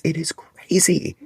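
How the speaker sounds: high, slow, drawn-out exasperation; exasperated disbelief. Real, not acted. Ranting about needing three separate wristbands, exasperated. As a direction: stretch each word high in disbelief.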